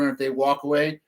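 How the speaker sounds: normal talking voice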